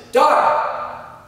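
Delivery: sharp, high, loud call; loud name call. Shown as a demonstration. Teacher to a distracted child in a big class, loud name call. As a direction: call the name out loudly to stop everything. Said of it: "I'll say their name really loud".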